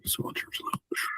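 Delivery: unvoiced, barely audible whisper; muttered under the breath while searching; whispered aside. Real, not acted. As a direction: breathe the name to yourself. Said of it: "reading window name aside".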